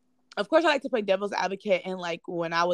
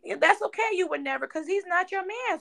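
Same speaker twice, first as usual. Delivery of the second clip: much higher, sarcastic sing-song; mock the friend's fake innocence, pitched way up; high mocking sass, voice pushed up; 12 semitones higher than usual.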